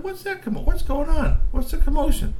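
very high, loud, demanding troll voice; shrill troll auntie. Acted. Kitchen aunties demanding to know what the commotion is, very high and loud. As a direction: screech the question like a bossy auntie.

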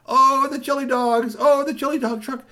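high, delighted cry over the truck; excited. Acted. Kid spotting the truck, high excited cry. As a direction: squeal with joy at the sight of food. Curtis spots the chili dog truck.

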